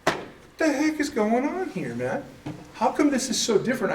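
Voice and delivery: imitating his own past confusion, pitched high; hammed-up baffled complaint, high and strained; exasperated mock outburst. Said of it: "And I'm like".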